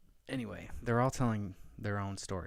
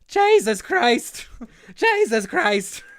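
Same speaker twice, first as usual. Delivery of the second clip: slow, very loud, high Irish shout; Irish beat cop yelling; 17 dB louder, much slower than usual. Retold or imitated. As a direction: bellow it like an outraged Irish cop. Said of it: "He's an Irish beat cop".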